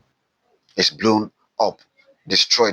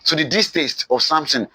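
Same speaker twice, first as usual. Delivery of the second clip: ordinary delivery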